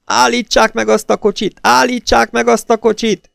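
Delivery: high, loud desperate yell; shouting from afar. A narrator's reading. Sister-in-law yelling after the fleeing car, desperate shout. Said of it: "hallotta a sógornője kiabálását".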